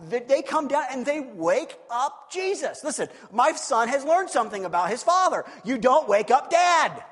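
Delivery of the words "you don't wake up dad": loud, slow warning; slow emphatic shout. Real, not acted. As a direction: drive home the warning. Warning about waking dad.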